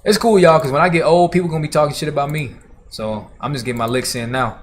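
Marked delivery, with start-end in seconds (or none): 0.0-2.5 excited outburst. Real, not acted. Laughing justification to chat.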